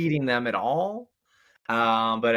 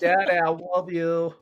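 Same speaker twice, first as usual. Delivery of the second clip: put-on little boy voice; 6 semitones higher, 4 dB louder, slower than usual. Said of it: "My little boy goes".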